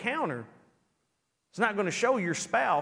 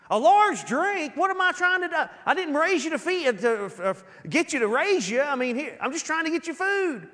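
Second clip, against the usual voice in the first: higher, louder incredulous complaint; hammed-up outrage at a small request; mock exasperation; 8 semitones higher, 4 dB louder than usual. Giver griping at the hungry man, mock outraged. Grudging giver voiced at McDonald's.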